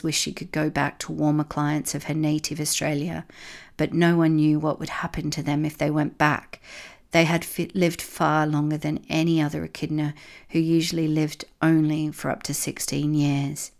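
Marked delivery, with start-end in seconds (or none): none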